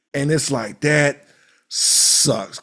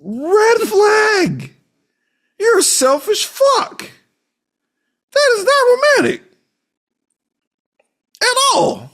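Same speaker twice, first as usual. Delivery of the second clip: high, loud disgusted yell; outraged shout; 6 dB louder than usual. Real, not acted. Yelling at the husband on screen in loud, high outrage.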